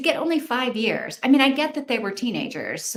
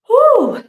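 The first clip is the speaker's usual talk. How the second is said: loud, high-pitched exhaled whew; 12 dB louder than usual. Real, not acted. Reader reacting to a shocking true-crime story, loud high whew. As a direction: let out a big, high "whew".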